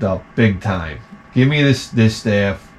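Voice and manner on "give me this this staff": ordinary talk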